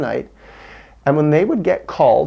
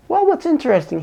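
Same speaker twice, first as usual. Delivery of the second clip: quoted Marine; 13 semitones higher, faster than usual. Retold or imitated. Quoting the Marine.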